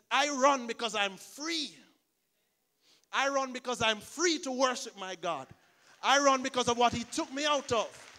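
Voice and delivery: loud, high preaching at full force; fervent proclamation. Retold or imitated. Pastor voicing Lacey's testimony to the church, loud fervent preaching. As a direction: proclaim freedom like a shout of victory.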